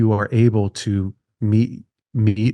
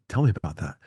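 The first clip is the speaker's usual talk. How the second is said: soft invitation; 6 semitones lower, 7 dB quieter, much faster than usual. Shown as a demonstration. Model gentle response to wife.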